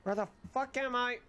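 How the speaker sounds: usual speaking voice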